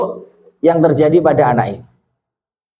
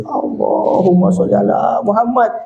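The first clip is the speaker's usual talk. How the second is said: high, tearful imitation of a weeping congregation; imitated tearful prayer, pitched up; mock weeping; 8 semitones higher than usual. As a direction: recite as if through tears. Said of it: "Itu jamaah semua nangis".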